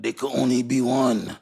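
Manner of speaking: drawn-out mock movie line; hammed-up Highlander quote; movie catchphrase. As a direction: intone it like an epic film quote. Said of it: "you remember the, the one where".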